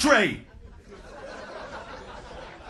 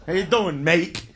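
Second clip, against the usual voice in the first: mock Australian; 6 semitones lower than usual. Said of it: "Isn't that what they say?".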